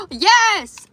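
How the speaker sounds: excited cheer. Real, not acted. Cheering for a parking spot.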